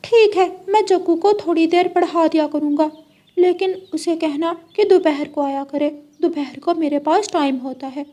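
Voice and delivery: lightly voiced fussy parrot; parrot uncle voice.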